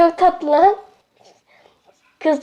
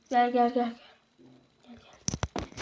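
whispered; 5 semitones lower, 12 dB quieter than usual. Real, not acted. Calling earphones softly.

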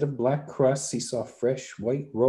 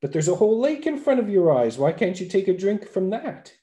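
amazed fool; 8 semitones higher, 4 dB louder than usual. A narrator's reading.

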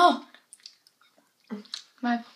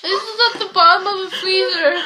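fast, laughing and louder; 7 semitones higher, 6 dB louder, faster than usual. Real, not acted. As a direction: talk through real giggles. Laughing through the cold.

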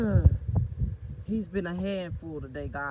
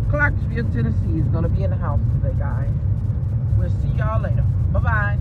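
loud announcement; 3 semitones higher, 8 dB louder than usual. Real, not acted.

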